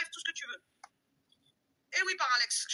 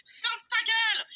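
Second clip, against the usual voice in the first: slow, loud, higher shout; furious shout; 8 semitones higher, 6 dB louder, slower than usual. Real, not acted. At the rival who insulted her, a slow furious shout. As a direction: bark it slowly at her.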